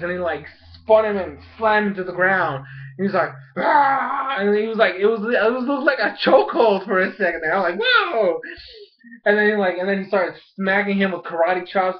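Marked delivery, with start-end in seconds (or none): 3.6-4.4 high mock scream; imitated pained yell. Imitates slammed Ursaring's cry. 7.8-8.4 high, drawn-out exclamation; amazed whoa. Retold or imitated. Acted amazed reaction to fight.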